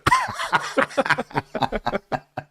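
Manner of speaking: bright burst of real laughter; laughing. Real, not acted. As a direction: laugh it off, amused at yourself. Laughs at own admission.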